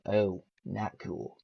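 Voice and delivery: soft, slow letdown "Oh, not cool"; disappointed. Retold or imitated. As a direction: sink into quiet dismay. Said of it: "I'm like".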